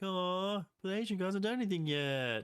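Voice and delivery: high, whiny cartoon disappointment; Homer. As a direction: moan it like a dim cartoon dad. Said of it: "He's like".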